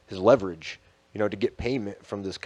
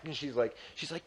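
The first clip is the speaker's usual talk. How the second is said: usual speaking voice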